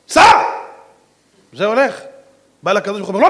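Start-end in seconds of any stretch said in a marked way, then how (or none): 0.1-0.4 sudden high, very loud command; shouted order. Retold or imitated. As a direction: shout him off sharply. Retold rabbi orders the angel away.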